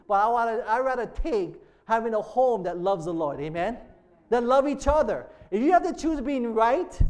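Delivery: emphatic, insistent pulpit voice; forceful sermon exhortation; impassioned preaching. Real, not acted. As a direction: drive the point home to the congregation.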